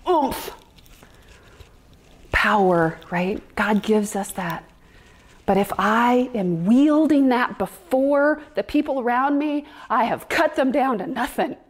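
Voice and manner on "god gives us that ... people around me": normal talking voice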